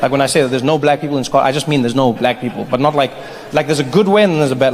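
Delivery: ordinary delivery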